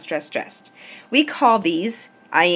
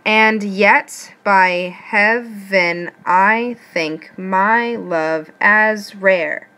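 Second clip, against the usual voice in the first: very slow, every syllable its own beat: and YET by HEA-VEN I THINK MY LOVE AS RARE; counting out syllables; 4 dB louder, much slower than usual. Shown as a demonstration. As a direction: measure out "heaven" and each word beat by beat. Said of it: "Let's count these syllables".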